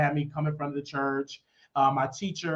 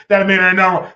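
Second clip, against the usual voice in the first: loud, raised drill sergeant rebuke; mock stern yell; 4 semitones higher, 10 dB louder, faster than usual. Drill sergeant scolding faking recruit, mock stern yell. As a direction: bear down on the recruit.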